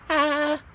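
high little squeal; a delighted squeal over the bargain; excited squeal. Real, not acted.

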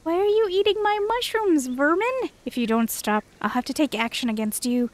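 a lightly voiced, haughty creature scolding a fox; haughty forest creature. Reads a game character's lines.

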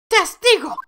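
high, sharp call to order; stern prosecutor. Acted. Prosecutor interrupts rambling witness, high and sharp. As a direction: cut the witness off, high-pitched.

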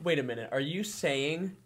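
slow, drawn-out fake astonishment; sarcastic mock disbelief. Host mocks the nonviolent communication idea, slow sarcastic disbelief. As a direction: act stunned by the obvious, dragging it out.

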